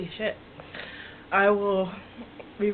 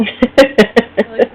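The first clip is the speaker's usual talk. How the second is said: loud laughing line; 3 semitones higher, 15 dB louder than usual. Real, not acted. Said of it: "the hiccup stopped".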